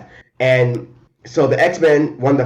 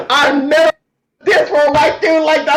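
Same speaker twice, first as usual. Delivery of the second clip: high, loud, laughing jab in his voice; laughing mock insult from the hero; mock gruff hero; 5 dB louder than usual. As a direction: throw the insult like the cocky hero. Quoting Thunderbird's insult.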